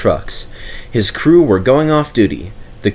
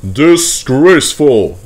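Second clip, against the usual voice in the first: slow, louder outraged word; rumbling indignation; 5 semitones higher, 6 dB louder, slower than usual. A narrator's reading. Gordon at the indignation meeting, slow and louder. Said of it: "rumbled Gordon".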